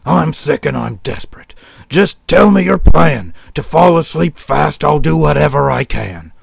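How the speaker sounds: hammed-up desperate plea; desperate sick sheep. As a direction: beg for the plan as a sick sheep. Sick sheep begging the shepherd.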